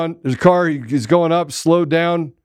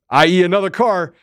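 ordinary talk, nothing marked

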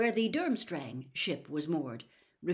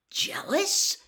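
high, disbelieving question on Jealous?; incredulous; 13 semitones higher, 8 dB louder than usual. A narrator's reading. Harry to Hermione, hearing Ron is jealous, incredulous. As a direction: throw the word back in disbelief. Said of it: "Harry said incredulously".

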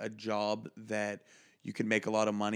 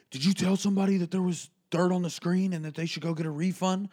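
higher, louder accusing boss; angry boss; 7 semitones higher, 5 dB louder, faster than usual. Retold or imitated. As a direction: confront the worker, demanding. Boss runs up confronting him.

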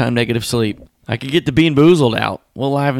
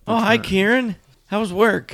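sitcom wife voice; 11 semitones higher than usual. Acted. Mock sitcom greeting.

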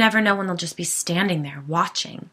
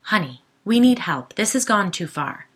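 pleading; 3 semitones higher, 7 dB louder than usual. Acted. Husband arguing with wife.

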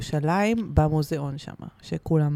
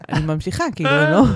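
usual speaking voice

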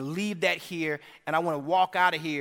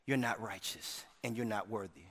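ordinary talk, nothing marked

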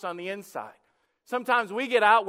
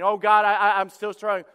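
louder mock complaint; loud lament; 8 dB louder than usual. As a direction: moan out the excuse loudly. Acted plea to God.

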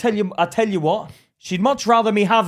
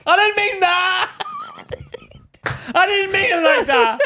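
laughing; 10 semitones higher than usual. Real, not acted. Embarrassed backpedal while laughing.

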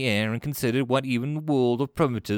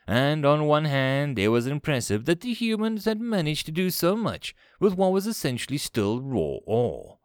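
usual speaking voice

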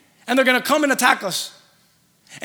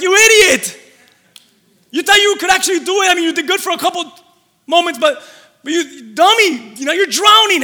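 mocking scold; 6 semitones higher, 4 dB louder than usual. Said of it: "some of the disciples were like".

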